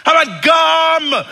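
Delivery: high, loud shout with a stretched last word; retold yelling. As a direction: holler out a silly suggestion. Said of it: "so we started yelling".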